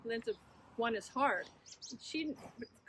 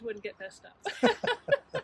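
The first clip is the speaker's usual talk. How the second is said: laughing; 7 dB louder, much slower than usual. Real, not acted. Laughs about keeping score.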